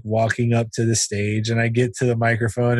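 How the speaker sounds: ordinary delivery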